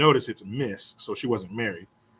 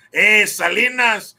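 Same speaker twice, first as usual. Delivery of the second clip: loud, slow, higher-pitched call; movie character impression; 6 semitones higher, 8 dB louder, slower than usual. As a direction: draw it out as an excited movie quote. Quotes a character from Selena film.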